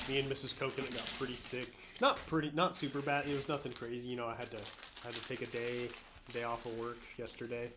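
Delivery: ordinary talk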